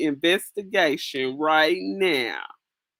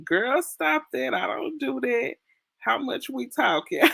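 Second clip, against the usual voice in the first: playful, higher voice, laughing at the end; higher, giggly voice; sassy mock protest; 7 semitones higher than usual. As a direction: tease it like you're shocked but interested. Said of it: "Coco said".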